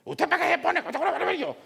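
high, loud mock scolding wife. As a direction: nag him like his annoyed wife. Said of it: "me van regañando las dos". (esposa regañona imitada, aguda y fuerte)

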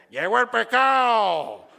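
loud drawn-out vendor's cry; slow, blaring hawker through a bullhorn; street vendor on a megaphone. Acted. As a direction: call out your wares to the whole street. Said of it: "la bocina esa que usan en nuestros países".

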